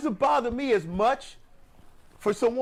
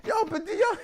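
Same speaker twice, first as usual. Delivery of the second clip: fired-up shout; 11 semitones higher than usual. Real, not acted. Fired up at cohosts.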